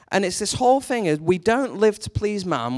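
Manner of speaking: usual speaking voice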